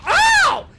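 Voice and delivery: high, loud cry of pain; sudden high-pitched "ow"; pained yell. Acted. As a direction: yelp loudly as if hit. Hit during the game.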